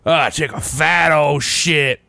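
slow, lightly hammed-up drunk voice; drunk dude. Retelling a drunk guy announcing himself, slow mock drunk. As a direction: drawl it like a chatty drunk in the next stall. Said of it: "He's just like".

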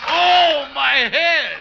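high, loud cry of pain; pained yelp. Acted. Husband hurt bending over the bed, pained yelp. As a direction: yelp as the head is hit.

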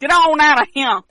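shouting. Pretend. Ghosts chasing them off.

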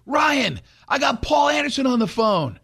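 high, shouted call across the fence; agent calling out. Retold or imitated. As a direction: yell to get Ryan's attention. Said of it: "and he goes".

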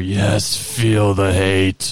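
slow, menacing emperor voice; cold rasping evil emperor. Retold or imitated. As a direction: draw it out like a sinister Sith lord. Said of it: "What was it the Emperor said to Luke".

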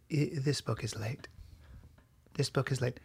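very quiet, low librarian murmur. Acted. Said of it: "my impression of a librarian".

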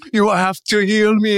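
high, slow tragic pleading; Greek tragedy plea. Retold or imitated. Corpse pleading with Julia to heal him, high and tragic. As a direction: wail it like a tragedian. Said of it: "like Greek tra".

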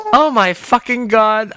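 high, drawn-out cry of dismay; real disbelieving outburst; dismayed exclamation. Real, not acted. Realises it was not recorded.

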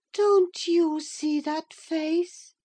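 high, slow, haunted question; uneasy delirium. Acted. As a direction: ask it slowly in a high, fearful voice. Catherine frightened by her reflection.

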